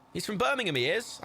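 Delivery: put-on high-pitched stab at a Brummie accent; attempted Brummie accent. Streamer trying out a Birmingham character's voice, shaky-attempt Brummie accent. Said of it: "I can't do a Brummie accent".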